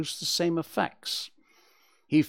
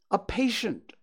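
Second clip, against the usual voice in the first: lightly higher wife's voice, a touch distant; 7 semitones higher than usual. A narrator's reading. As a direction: give the wife a slightly higher tone. Wife's remark in read story.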